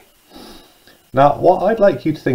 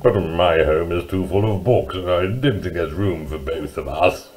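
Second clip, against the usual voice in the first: low, muffled storybook mole; mumbling mole; 5 semitones lower, 3 dB quieter than usual. Acted. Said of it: "mumbled Mole".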